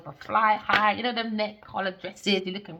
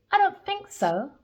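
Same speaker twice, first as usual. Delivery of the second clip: sassy high retort; 10 semitones higher than usual. Real, not acted. Defiant retort to neighbor.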